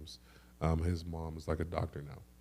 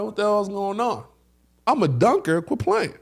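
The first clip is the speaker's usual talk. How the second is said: high-pitched, incredulous self-pep talk; indignant self-talk; 13 semitones higher, 4 dB louder than usual. Retold or imitated. Dunker hyping himself after missed jumps, indignant. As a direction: scold yourself in disbelief, pitched way up.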